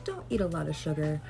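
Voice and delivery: ordinary delivery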